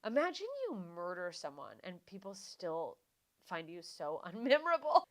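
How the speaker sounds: ordinary talk, nothing marked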